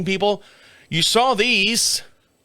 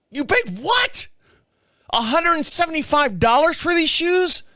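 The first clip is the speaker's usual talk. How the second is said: high, outraged "You paid what?"; shocked at price; 10 semitones higher than usual. Retold or imitated. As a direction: recoil at the price tag.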